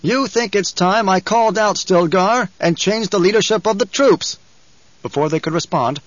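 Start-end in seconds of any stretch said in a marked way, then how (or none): 0.0-4.4 higher, projected challenging question; raised voice. A narrator's reading. Paul challenging the troops about Stilgar, voice raised. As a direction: call it out to the whole crowd. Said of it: "Again, Paul raised his voice".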